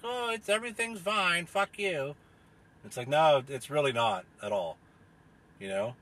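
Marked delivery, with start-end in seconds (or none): 0.0-2.2 higher smug brush-off; mock an official waving it away; sarcastic pundit voice. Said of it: "go on T V and try to be like".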